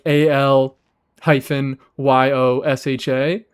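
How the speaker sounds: "A L hyphen Y O S H A" spelled slowly, letter by letter; spelling letter by letter. Shown as a demonstration. Streamer spelling the name out slowly for chat. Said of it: "I'm saying it right now".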